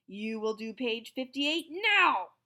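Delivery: loud, slow thunderous order; thundering. A narrator's reading. Stern teacher thundering an order, loud and slow. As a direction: thunder the command. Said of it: "thundered Mister Kinderschmacker".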